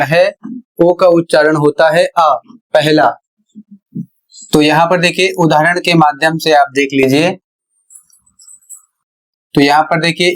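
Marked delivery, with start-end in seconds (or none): none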